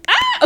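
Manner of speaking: sudden high squeal of delight; excited squeal. Real, not acted. Squealing as she opens the box of books. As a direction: squeal at a great find.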